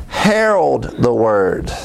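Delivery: ordinary talk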